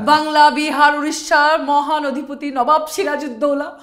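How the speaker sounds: loud, declaiming folk theatre voice; jatra dialogue. Acted. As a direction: proclaim it like a stage herald. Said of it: "যাত্রার dialogue".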